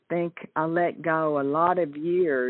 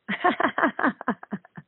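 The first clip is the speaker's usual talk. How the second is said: short high laugh; real laugh at her own margarita joke; 10 semitones higher than usual. Real, not acted.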